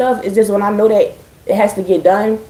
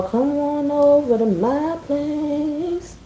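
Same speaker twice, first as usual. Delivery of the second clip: slow, soft, drawn-out come-on, pitched up; suggestive invite; 7 semitones higher, 7 dB quieter, much slower than usual. Pretend. Streamer teasing viewers to her Twitch, slow suggestive come-on. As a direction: purr the invitation slowly like a flirt. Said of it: "I mean literally".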